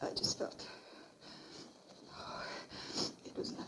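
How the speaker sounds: hushed, frightened denial; scared whisper. Acted. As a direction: whisper it, barely holding on. Scared, hiding her fear.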